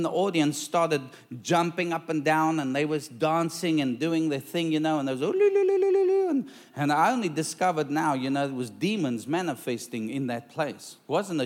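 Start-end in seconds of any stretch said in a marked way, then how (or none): 5.2-6.3 high, loud imitated ritual chant; mock chant. Preacher imitating the witch doctors' high loud chant. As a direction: wail it out high like the dancing crowd.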